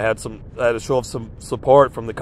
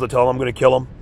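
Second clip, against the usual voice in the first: ordinary talk, nothing marked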